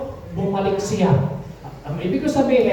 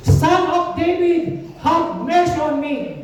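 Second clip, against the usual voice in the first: slow, drawn-out calling out, pitched up; Bartimeo's imitated cry; 5 semitones higher, slower than usual. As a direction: imitate a blind man calling for mercy. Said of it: "Yun ang sigaw ni Bartimeo". (ginayang mahabang pagtawag)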